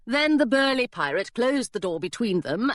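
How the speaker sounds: plain reading voice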